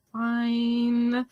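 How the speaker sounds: drawn-out sulky compliance; grudging kid. Retold or imitated. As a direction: give in like a sulking child, stretching the word. Child grudgingly obeys parents.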